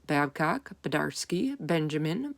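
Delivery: usual speaking voice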